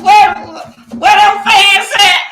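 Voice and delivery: high, loud call to the fans; shout. Real, not acted. Calling out to his fans in a loud shout. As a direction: yell out for the fans.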